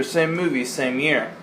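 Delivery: ordinary talk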